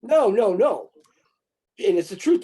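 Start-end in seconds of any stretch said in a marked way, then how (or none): 0.0-0.9 high, loud, indignant no; hammed-up shocked protest; mock outraged refusal. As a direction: squeal it like scandalized officials. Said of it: "It's like".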